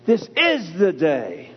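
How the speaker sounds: IS hit hard and drawn out; slow down and punch the word IS; stress on IS. Shown as a demonstration. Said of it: "When you break it up and say".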